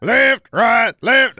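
barked marching cadence, loud and high; stiff barking toy soldier. Pretend. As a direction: bark it like a drill sergeant. Mock drill sergeant marching call.

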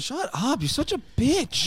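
high mocking taunt. Teasing in a put-on voice.

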